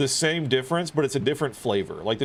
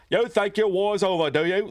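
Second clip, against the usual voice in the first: higher, Muppety mock Cockney, mouth screwed up to one side; mock Cockney Grog voice; 10 semitones higher, 4 dB louder than usual. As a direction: replay the vetoed Cockney Grog line, hammed up. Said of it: "kinda like Cockney".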